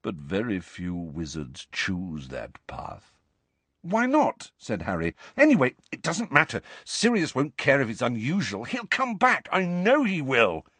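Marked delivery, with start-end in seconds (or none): 0.0-3.1 slow, low, soft regret; miserable. A narrator's reading. Nick admits few choose his path, miserable. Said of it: "said Nick miserably". 3.8-4.5 high, quick demand; challenging. A narrator's reading. Harry questions Nick's explanation, challenging. 5.4-10.7 high, insistent belief; desperate conviction. A narrator's reading. Harry insists Sirius will return, desperately sure.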